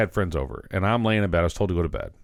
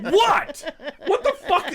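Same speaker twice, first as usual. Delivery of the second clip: put-on indignant kid through laughter; much slower than usual. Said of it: "I'm like".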